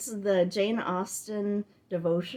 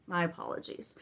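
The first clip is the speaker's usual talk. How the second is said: ordinary talk, nothing marked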